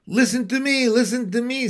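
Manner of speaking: high-pitched insistent goose; urgent, squawky plea without full screaming; mild scream. A narrator's reading. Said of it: "screamed the goose".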